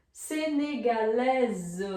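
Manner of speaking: Senegalese drawn out, ending "LÈ-zuh"; French feminine pronunciation. Shown as a demonstration. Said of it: "quando tá no masculino". (Senegalese devagar, com som de Z no final)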